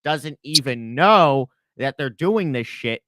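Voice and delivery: normal talking voice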